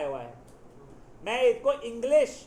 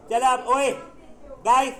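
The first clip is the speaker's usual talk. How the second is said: loud, higher classroom call; calling for attention; 4 semitones higher, 8 dB louder than usual. Real, not acted. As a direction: call the students to order loudly. Teacher calls class to attention.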